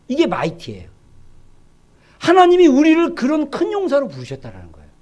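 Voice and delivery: ordinary delivery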